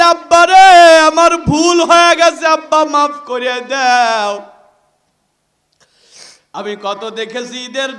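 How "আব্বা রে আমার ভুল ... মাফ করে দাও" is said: loud, high plea for forgiveness; anguished cry. Acted. Son begs father's forgiveness.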